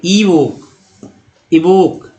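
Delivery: "इवोक" starting with an ee sound; untrained learner's pronunciation. Shown as a demonstration. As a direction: say "इवोक" as ee-vok. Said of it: "जिनको नहीं पढ़े हैं बोलेंगे".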